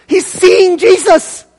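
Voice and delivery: high, drawn-out thrilled exclamation; excited. Acted. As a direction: preach it with soaring excitement. Preacher shares John's excitement.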